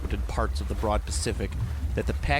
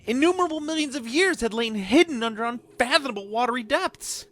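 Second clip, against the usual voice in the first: much higher, mock squeaky reading with giggles breaking through; 13 semitones higher than usual. Reader of a spooky tale losing composure, squeaky and giggling. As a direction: push the voice way up and fight back laughter.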